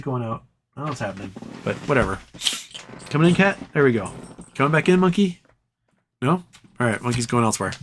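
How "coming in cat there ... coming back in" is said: ordinary talk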